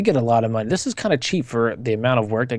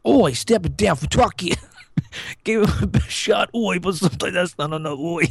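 mock British accent; 7 semitones higher than usual. Reading the presenter's tweet to mock him in a put-on British accent. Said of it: "You're supposed to read it in British".

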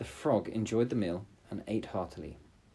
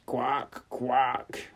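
frog croak; 3 semitones higher than usual. Acted. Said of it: "to cry out".

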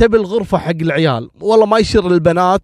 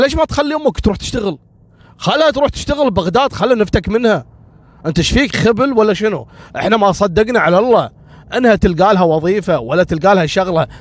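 higher, fast and scolding; nagging wife; 4 semitones higher, faster than usual. Acted. Wife Khawla scolding her husband Adel to send his mother away, higher and fast.

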